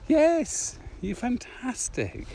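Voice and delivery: high, emphatic, then slow and drawn out; big enthusiastic compliment; gushing praise. Real, not acted. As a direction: pile on the praise, slow on fantastic. Gushing praise of her creativity.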